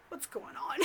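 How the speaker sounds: retold whispered thought; baffled whisper. Thinking to herself about Gary at the door, baffled whisper. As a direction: whisper it in mock bewilderment.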